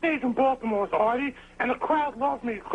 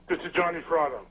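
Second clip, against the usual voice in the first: lower, rough-edged tough-guy voice; gruff voice; 5 semitones lower than usual. Retold or imitated. Caller doing a gruff Johnny-style impression, lower and rough. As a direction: growl it out like a gravel-voiced goon. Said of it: "with his gruff voice".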